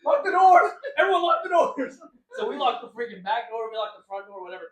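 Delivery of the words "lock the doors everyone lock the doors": loud, high shouted orders; mock panic, shouted high; panicked shout. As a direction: yell to lock everything, laughing at the end. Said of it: "I'm like".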